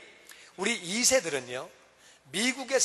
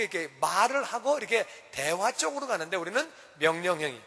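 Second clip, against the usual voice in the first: ordinary talk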